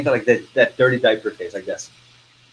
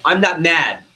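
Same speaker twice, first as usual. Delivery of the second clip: loud, raised snap of denial; mock angry denial; 5 semitones higher, 3 dB louder, slower than usual. Girlfriend denying to boyfriend that she's mad, mock angry. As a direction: insist it hotly, hammed-up. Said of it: "why are you mad?".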